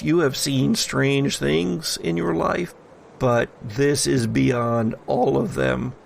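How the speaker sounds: usual speaking voice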